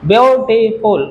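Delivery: ब्यूटीफुल slowly split, ब्यू-टी-फुल; syllable by syllable. Shown as a demonstration. Teacher demonstrates syllables to the class, ब्यूटीफुल broken into three parts. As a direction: sound out ब्यूटीफुल in three slow, separate beats. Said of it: "ऐसे बोला जाता है इसको".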